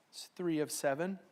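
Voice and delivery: ordinary talk, nothing marked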